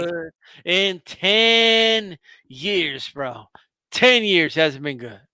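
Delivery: very slow and drawn out; slow drawn mocking jab; slow taunt. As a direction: spell it out slowly to needle him. Repeating the taunt.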